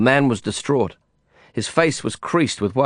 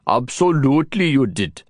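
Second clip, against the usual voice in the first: mocking; 3 semitones higher than usual. A narrator's reading. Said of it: "he mocked".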